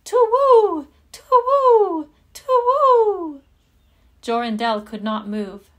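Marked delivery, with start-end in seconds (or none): 0.0-3.4 loud, much higher owl screech, falling each time; screaming owl hoots. Acted. The owl circling the lovers, shrieking loud high hoots. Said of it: "three times screamed".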